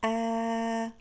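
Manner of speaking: "آ" held as one long, steady aa; stretched-out demonstration of "آ"; long vowel. Shown as a demonstration. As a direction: sustain "آ" on a level note. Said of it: "أما صوت الألف فهو طويل".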